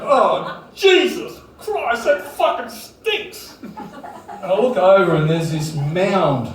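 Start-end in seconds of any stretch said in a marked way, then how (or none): 0.1-3.6 high, slow, laughing disgusted cry; disgusted woman. Retold or imitated. Woman in the bed shrieking at the smell, high and slow.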